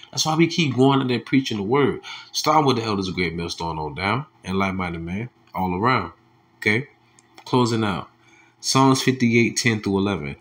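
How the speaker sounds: ordinary talk, nothing marked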